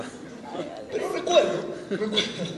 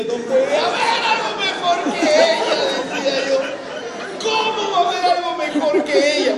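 high, loud, laughing protest; man protesting to God that no one is better, loud and high; incredulous outcry; 9 semitones higher, 7 dB louder than usual. Retold or imitated. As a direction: cry out in disbelief.

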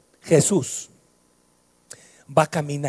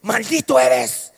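slow, loud, higher booming curse; dramatic curse; 8 semitones higher, 6 dB louder, much slower than usual. Retold or imitated. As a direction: pronounce a curse like a sorcerer, drawn out. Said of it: "maldecir a nuestros hijos es decirle".

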